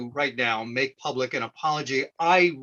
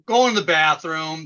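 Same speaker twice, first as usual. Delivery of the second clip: usual speaking voice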